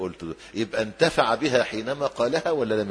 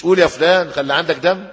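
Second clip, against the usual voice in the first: louder mock rebuke, higher and sharper; harsh scolding prompt; 5 semitones higher, 7 dB louder than usual. Preacher mocks a harsh prompt at a deathbed, louder scolding. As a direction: bark it like an impatient relative. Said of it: "مش".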